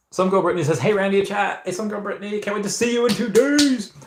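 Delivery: high put-on voice; playful voice change. Streamer greeting a chat regular, playful high voice. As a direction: pitch it way up, rising further toward the end. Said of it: "Love the voice change".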